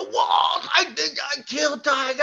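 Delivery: high, loud, panicked cry for help; panicked shout. Retold or imitated. Calling the medic to the fallen boy, panicked high shout. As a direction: yell for the medic in alarm. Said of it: "I was freaking out".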